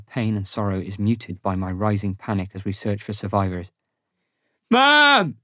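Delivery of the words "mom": high, loud shout for a missing mother; full-voiced, high-pitched call; desperate cry. A narrator's reading. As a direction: cry out, searching for her. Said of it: "I cry".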